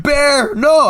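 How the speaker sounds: high-pitched mock wail of loss; imitated anguished cry. Player imitates enemies seeing their dog killed, mock anguished cry. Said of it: "and they're like".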